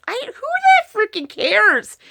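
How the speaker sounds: high, slowed, incredulous who-cares; a high, drawn-out so-what; exasperated. Real, not acted. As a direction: throw the question up in disbelief. Exasperated dismissal of dress worries.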